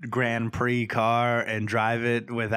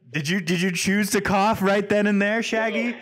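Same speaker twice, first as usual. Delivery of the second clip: mock outrage; 9 semitones higher, 4 dB louder than usual. Mock outrage at friend's cough.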